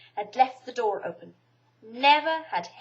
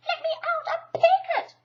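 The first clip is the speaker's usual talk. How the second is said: protesting Piglet; 7 dB louder than usual. A narrator's reading. Piglet struggling in bath.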